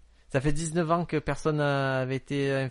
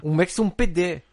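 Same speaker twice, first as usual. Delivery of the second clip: higher and louder gibberish in a mock Brazilian accent; fake Brazilian Portuguese; 4 semitones higher, 5 dB louder, slower than usual. Fake Brazilian accent, proving he speaks Brazilian. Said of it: "dis, euh, il fait beau en brésilien".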